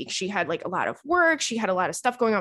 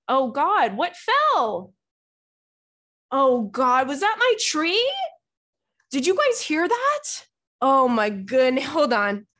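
startled alarm; 3 semitones higher, slower than usual. Real, not acted. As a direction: react to a crash off camera. Something fell during recording.

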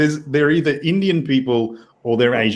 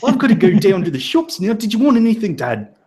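put-on high, quick Kiwi bro accent; chirpy New Zealand driver, fast and pitched up; mock Kiwi driver; 5 semitones higher, faster than usual. Driver cheekily thanking the cop, in a mock Kiwi accent. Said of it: "I think I'm losing the accent now".

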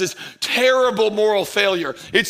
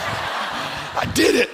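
breathy gleeful laugh; 5 semitones higher, 4 dB quieter, much slower than usual. Real, not acted. Gloating over the closed shop.

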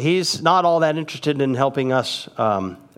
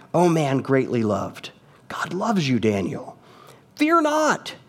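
whisper. Acted. Angel's tender words, whispered.

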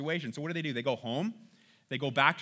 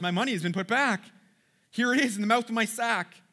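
higher, louder exclamation of dismay; alarmed discovery; 7 semitones higher, 5 dB louder than usual. Acted. Brother finds his money back in the sack, alarmed exclamation. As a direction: blurt it out to the others, pitch climbing.

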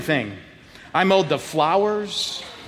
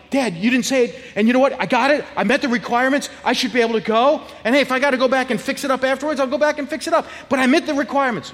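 put-on higher-pitched kid pleading his case; arguing; 6 semitones higher, faster than usual. Twelve-year-old argues with dad about the lawn, higher, indignant. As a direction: rattle out your defence to dad, pitched up. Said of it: "I'm arguing".

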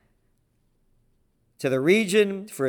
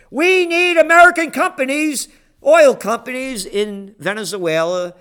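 Bolton impression; 8 semitones higher, 7 dB louder than usual. Quoting Bolton in put-on voice.

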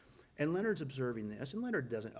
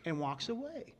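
ordinary talk

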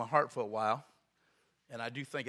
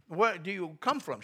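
put-on slow, raised foreign-accented question; broken English; 5 semitones higher, 6 dB louder than usual. Visitor asking clerk her hometown, broken English accent. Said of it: "he spoke real broken English".

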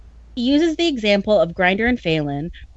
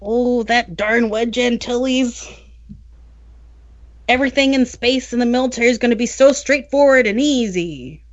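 whiny Kel voice; 4 semitones higher than usual. Acted. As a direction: sulk it out like a sore loser. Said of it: "like whiny Kel voice?".

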